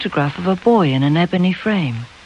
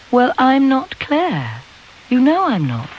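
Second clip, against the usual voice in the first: defiant; 8 semitones higher than usual. A narrator's reading. Girl refusing to be compared with Claire, defiant. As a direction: push back hard in a high girl's voice.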